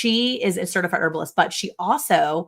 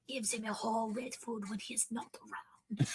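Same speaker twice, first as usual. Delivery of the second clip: slow secretive whisper; 4 semitones higher, 16 dB quieter than usual. Retold or imitated. Host whispering about dog food behind husband's back, whispered. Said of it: "She starts whispering".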